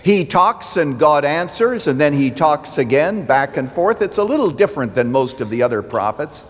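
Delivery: steady sermon voice, building in places; preaching. Acted. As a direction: preach it to the congregation.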